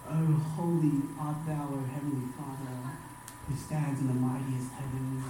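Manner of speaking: lightly mocked pious prayer, low and soft; hushed, mock-solemn churchy address; stiff formal prayer. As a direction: intone it like an overly reverent worshipper. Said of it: "have to be like that".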